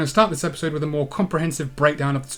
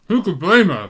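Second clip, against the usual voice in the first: loud, knowing hum then quip; wry agreement; 4 semitones higher, 6 dB louder than usual. Acted. Sidekick backing Lara's kill, loud and wry.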